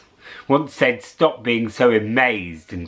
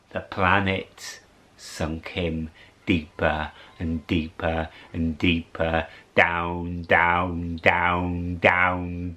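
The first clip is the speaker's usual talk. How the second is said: sinking low; 5 semitones lower, slower than usual. A narrator's reading. Narrating the sinking.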